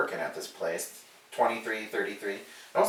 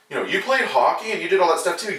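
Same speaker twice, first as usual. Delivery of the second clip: surprised customer; 6 semitones higher than usual. Retold or imitated. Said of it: "And he was like".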